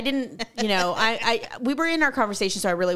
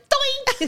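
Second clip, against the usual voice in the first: cartoon doink; 14 semitones higher than usual. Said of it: "You're like".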